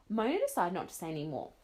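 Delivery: usual speaking voice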